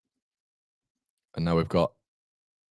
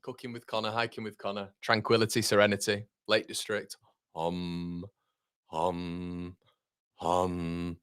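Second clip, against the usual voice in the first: mock meditation; 5 semitones lower, 8 dB quieter than usual.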